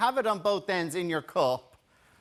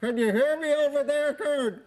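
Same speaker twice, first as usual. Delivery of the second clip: from across the street; 6 semitones higher than usual. Acted. Calling into string-cup phone.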